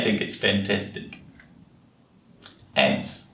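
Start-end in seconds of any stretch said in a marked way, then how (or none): none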